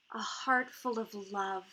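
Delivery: put-on a young suitor softly pledging his heart; tender earnest reply. A narrator's reading. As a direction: offer your love gently, almost shy. Elya pleads for Myra.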